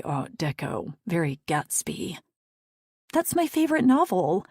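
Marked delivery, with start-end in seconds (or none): 3.1-4.5 higher, louder, thrilled; delighted. A narrator's reading. Daisy thrilled at her new Gatsby bob. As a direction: gush with delight.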